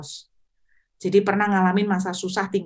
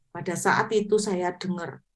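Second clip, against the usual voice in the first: usual speaking voice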